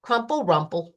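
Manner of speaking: ordinary delivery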